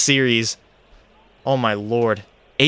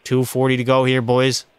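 usual speaking voice

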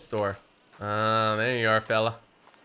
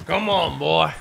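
loud, higher Southern cowboy call to his horse; hammed-up Southern drawl, louder; 4 semitones higher, 7 dB louder, slower than usual. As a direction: holler at the horse like an old Western cowboy. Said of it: "wanna talk like cowboy".

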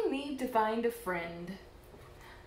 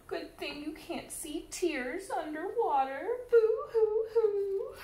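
crying; 8 semitones higher than usual. Acted. Lonely fish cries underwater.

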